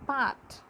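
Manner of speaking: "pot" with an open ah vowel; Hindi-style vowel. Shown as a demonstration. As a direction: say "pot" as "paht". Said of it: "in Hindi as".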